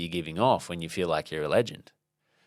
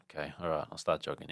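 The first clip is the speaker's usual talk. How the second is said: low, subdued grudging consent; reluctant agreement; 4 semitones lower, 9 dB quieter, faster than usual. Retold or imitated. Speaker giving in to an inner boss voice, reluctant. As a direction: give in quietly, as if talked into it.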